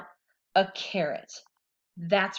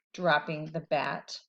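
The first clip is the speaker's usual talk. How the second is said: plain reading voice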